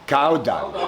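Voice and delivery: ordinary talk, nothing marked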